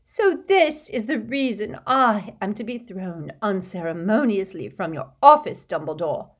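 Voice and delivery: an offended prophetess playing to the room; Trelawney accusing Dumbledore, high and theatrical; dramatic seer. A narrator's reading. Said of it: "pointing dramatically at Harry".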